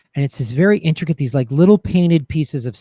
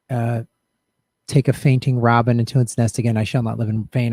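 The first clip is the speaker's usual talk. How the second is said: reciting the Emily Dickinson poem his stepmom gave him; poem recitation; 3 semitones lower, 3 dB louder than usual. Acted. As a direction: recite the lines with care, as if honouring her.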